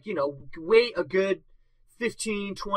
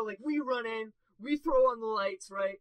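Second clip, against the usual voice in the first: normal talking voice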